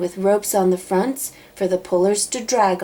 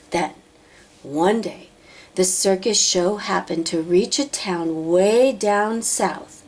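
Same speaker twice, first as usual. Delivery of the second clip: ordinary delivery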